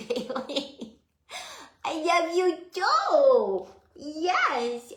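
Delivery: high, slow, loving coo to the dog; doting pet talk. Real, not acted. Talking to her dog Bailey, a high slow doting coo. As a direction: sweet-talk the dog, drawing the words out.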